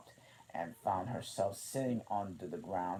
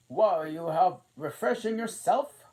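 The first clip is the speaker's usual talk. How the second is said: high, loud queenly question; the Queen questioning Alice; 10 semitones higher, 12 dB louder than usual. A narrator's reading. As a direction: ask it grandly.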